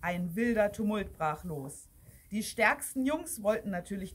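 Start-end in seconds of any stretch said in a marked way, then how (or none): none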